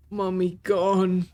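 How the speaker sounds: very slow, mournful broken words; sad simple creature. Acted. Fist about his lost mother, very slow and mournful. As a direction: let it drop slowly like a sad child.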